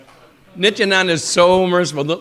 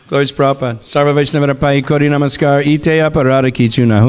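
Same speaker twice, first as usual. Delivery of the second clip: louder, level-pitched devotional formula; closing prayer recitation; 6 dB louder than usual. Real, not acted.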